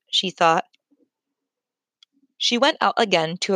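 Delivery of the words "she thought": ordinary delivery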